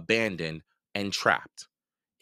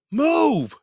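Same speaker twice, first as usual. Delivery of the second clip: much higher, louder shout; boy ordering brother to move; urgent command; 9 dB louder than usual. A narrator's reading. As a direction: bark it urgently.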